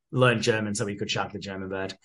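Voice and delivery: normal talking voice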